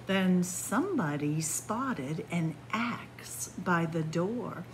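put-on lilting rhymed read-aloud for children; storybook reading. A narrator's reading. Reads picture book aloud.

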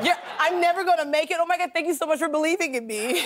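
high, hurried, laughing thanks; hammed-up cheerful acceptance; mock gushing gratitude. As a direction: gush your thanks, playing it up. Mock reply to harsh executive feedback.

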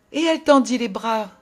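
usual speaking voice

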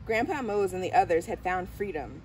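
usual speaking voice

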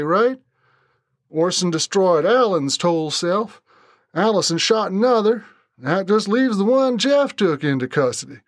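lightly drawled, defensive Brent. A narrator's reading. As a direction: shrug it off like a cornered Southern man. Brent deflects Charlie's questions.

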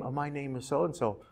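humble before royalty; shy mother. Acted. The mother answers the princess, very soft and shy.

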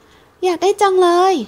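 put-on high, slow, longing little-girl voice; a small child aching for the chick; little girl's wish.

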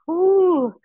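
high ooh. Real, not acted. Stretch after sitting up.

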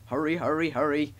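ordinary delivery, nothing marked

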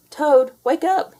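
high, loud wake-up call; cried out. A narrator's reading. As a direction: call Toad loudly out of bed. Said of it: "he cried".

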